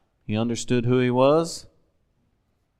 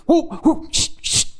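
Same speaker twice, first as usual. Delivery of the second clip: hushing. Retold or imitated. Jesus hushing the messengers.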